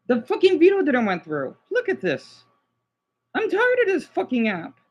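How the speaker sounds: high-pitched, exasperated venting; frustrated outburst. Real, not acted. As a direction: vent at the app, pitch jumping up. Fed up with failed upload.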